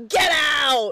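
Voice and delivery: loud, high, drawn-out yell. Retold or imitated. Lube to Spencer over the found radio, yelled. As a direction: yell it like you're throwing someone out. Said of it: "Lube yells".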